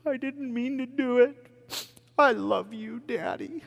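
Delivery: put-on high, soft child voice; lightly voiced tearful daughter; little girl voice. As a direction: speak as a tearful six-year-old. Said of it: "hugs me with tears".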